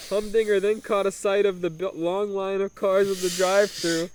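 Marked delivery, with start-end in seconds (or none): none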